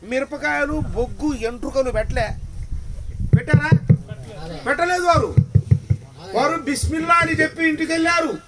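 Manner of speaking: loud rhetorical sermon questions; preaching at full force. Acted. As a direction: drive each question home to the crowd. Climax of the sermon story.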